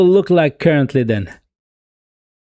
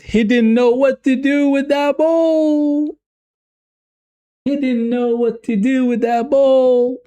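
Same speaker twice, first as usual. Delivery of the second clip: much higher, stretched-out disbelief; exasperated mock; 8 semitones higher than usual. Real, not acted. Fan groaning at Jesus's touch, high exasperated whine. Mocking repeat of complaint.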